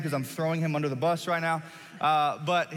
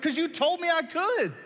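squeaky retort; 12 semitones higher than usual. Retold or imitated. Stuck hunter calling back to his buddy, squeaky protest.

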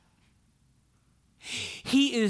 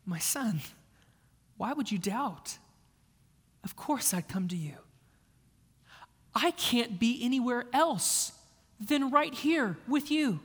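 tender dad; 3 semitones higher than usual. Pretend. Father reassuring his son in a hospital bed, tender. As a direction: comfort your sick son gently. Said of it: "That is Christ's tone".

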